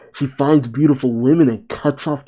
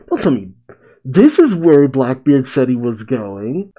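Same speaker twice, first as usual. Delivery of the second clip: ordinary talk, nothing marked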